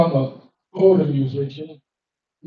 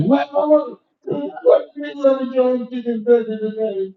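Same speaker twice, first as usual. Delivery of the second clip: shocked; 7 semitones higher than usual. Acted. Shocked kid asking mama.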